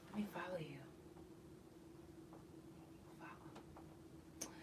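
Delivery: breathless whisper to herself; whispered aside. Real, not acted. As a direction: murmur it under your breath, almost unvoiced. Aside while following someone online.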